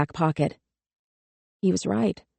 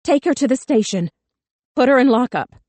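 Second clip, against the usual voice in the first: loud high pleading command; 7 semitones higher, 4 dB louder than usual. A narrator's reading. Charlie shouts orders at cops.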